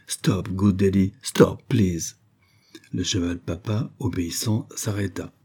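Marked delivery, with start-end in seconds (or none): none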